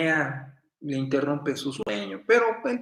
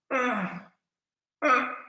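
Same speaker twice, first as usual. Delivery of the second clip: imitated soft moans; 9 semitones higher than usual.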